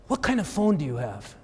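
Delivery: lightly put-on French accent. Seatmate asks about his phone, light mock French accent. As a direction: imitate a curious Frenchwoman, accent lightly faked. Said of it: "in kind of this French accent".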